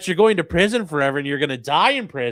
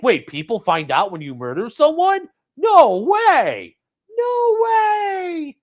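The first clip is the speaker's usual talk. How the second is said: higher mock disbelief rising on no way; mock surprised; 7 semitones higher, 4 dB louder, slower than usual. Streamer mocking the killer's surprise, mock shock. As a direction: play dumb, shocked.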